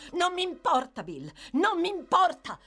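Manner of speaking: high, loud defiant scream. A narrator's reading. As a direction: yell it in desperate defiance. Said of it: "urlò lei".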